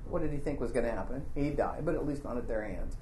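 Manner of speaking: low, soft and quick; muttered aside. Real, not acted. Preacher's aside on the brother's plan, muttered low. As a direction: toss it away under your breath.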